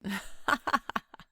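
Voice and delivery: a sudden, loud fit of laughing; amused laughter. Acted. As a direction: burst of rapid chuckles. Listener laughs at the video.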